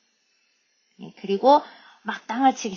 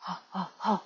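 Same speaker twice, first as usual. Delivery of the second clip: soft, short gorilla hoots; low voice; 8 dB quieter, much slower than usual. A narrator's reading. Big Guy stops by Jack, soft low-voiced hoots. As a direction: hoot gently, well under speaking volume. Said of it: "he said in a low voice".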